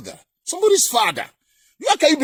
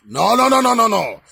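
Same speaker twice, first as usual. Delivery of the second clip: ordinary talk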